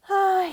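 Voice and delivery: a loud wistful "Ah"; envious little sigh; longing sigh. A narrator's reading. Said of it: "he sighed".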